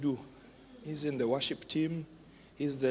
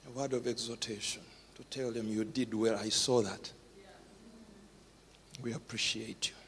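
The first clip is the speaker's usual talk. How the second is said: much softer, lower words; choked tender words; tearful soft; 5 semitones lower, 9 dB quieter than usual. Real, not acted. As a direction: speak through tears. Emotional soft talk.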